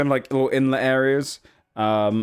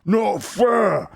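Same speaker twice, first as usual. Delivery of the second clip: high, hammed-up ogre complaint; sulky ogre shout; 10 semitones higher, 3 dB louder than usual. Losing ogre sitting by his brother, high sulky shout. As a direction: shout it like a sore loser. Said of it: "sits down next to the short one and goes".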